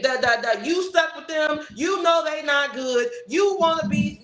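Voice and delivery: mock preachy scolding, slowed down; condescending lecture. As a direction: talk down to them like children.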